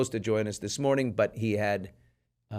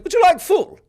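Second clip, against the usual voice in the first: high, loud hammed-up English accent; mock English accent; 9 dB louder, slower than usual. Host imitating an English gas attendant, hammed-up English accent. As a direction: play a prim English pump attendant with a put-on accent. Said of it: "You ever had an Englishman walk up and be like".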